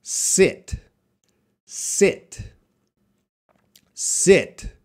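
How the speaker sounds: short lax i in "Sit", mouth barely moving. Shown as a demonstration. Teacher modelling "Sit" for the class to repeat. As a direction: keep the i in "Sit" short and relaxed. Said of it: "repeating after me".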